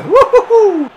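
high, loud joyful whoop; celebration whoop. Real, not acted. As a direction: let out a real victory cheer. Celebrates a goal.

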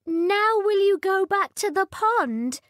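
high, loud impatient princess; hopeful spoiled princess; cartoon princess. A narrator's reading. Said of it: "said the princess".

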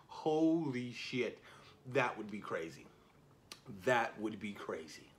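ordinary delivery

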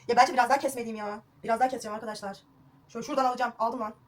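normal talking voice

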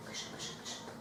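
whispered urging to keep writing. Real, not acted. Teacher whispering to class, keep writing. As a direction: breathe it, just air, coaxing.

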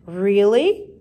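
loud, rising note of surprise; surprised question. A narrator's reading. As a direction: look up in hopeful disbelief. Superintendent reacts to the unexpected offer.

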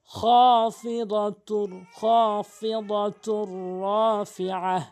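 slow melodic tilawat ending on রাফিআহ; Quran recitation. Shown as a demonstration. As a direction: chant slowly as the model reading. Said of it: "এভাবে তেলাওয়াত করবেন।".

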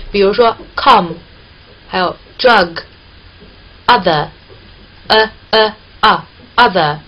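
come, drug and other said slowly with a stretched open 啊 vowel; slow teacher demo of the vowel. Shown as a demonstration. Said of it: "是这样发音啊".

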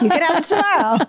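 high, fast, chirpy parent voice; singsong parent. Retold or imitated. Mom playing a parent promising candy tomorrow. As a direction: breezily put the kid off.

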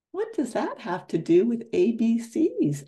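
a hint of Tigger, up high. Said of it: "asked Tigger".

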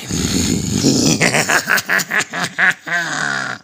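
drawn-out wicked cackle; evil cackle. Acted. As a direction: gloat with a cackle. Goblin villain laughing.

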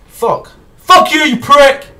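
high, loud shouted insult; furious shout; angry outburst. Real, not acted. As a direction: hurl the insult.

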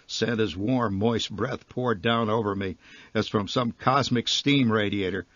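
ordinary delivery, nothing marked

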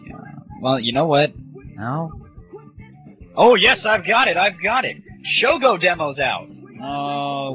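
ordinary delivery

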